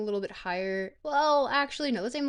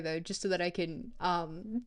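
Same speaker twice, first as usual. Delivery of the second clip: ordinary talk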